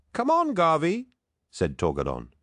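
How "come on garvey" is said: very high-pitched, cajoling protest; chiding protest. A narrator's reading. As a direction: talk a friend out of worrying, voice way up. Torgadon chides worried Loken.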